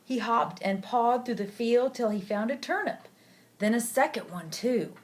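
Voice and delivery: usual speaking voice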